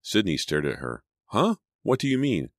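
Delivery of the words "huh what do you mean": very high startled question; incredulous, pitched-up query; baffled surprise. A narrator's reading. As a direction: squeak up in confusion. Baffled by friend's claim.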